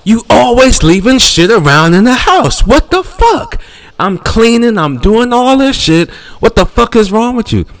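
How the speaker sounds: high, loud mock screaming wife; hammed-up furious girlfriend; wife yelling. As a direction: shriek at him like an angry wife. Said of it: "She walks into the kitchen and goes".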